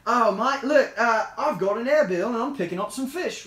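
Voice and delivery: put-on Australian accent. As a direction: try a cheery-bloke Australian impression. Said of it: "I'll try to do an Australian".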